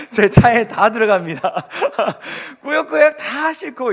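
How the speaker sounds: laughing. Real, not acted. Laughing at packed car.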